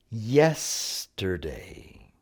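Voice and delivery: "Yesterday" very slow, YES drawn out; stress on YES. Shown as a demonstration. Teacher models the syllable to emphasize, "Yesterday" stressed on YES. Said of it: "syllable you should emphasize".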